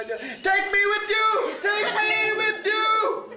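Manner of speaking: high, slow wailing cry; imitated wailing. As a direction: wail it out like a grieving old auntie. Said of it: "she starts wailing".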